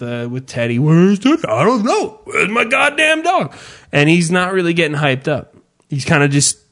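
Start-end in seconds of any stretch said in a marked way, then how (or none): 0.8-3.5 high, rising sing-song tease; taunting the dog. Retold or imitated. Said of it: "I'm doing the bullshit with Teddy".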